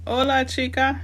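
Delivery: cheesy Spanish hello to the chat; mock Spanish greeting. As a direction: toss it off like a tourist showing off. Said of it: "That's the extent of my Spanish".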